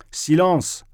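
high, sharp cry for silence; exclaimed order. A narrator's reading. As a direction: call the room to order. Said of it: "s'écria Ferfitchkin".

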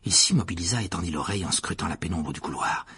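plain reading voice

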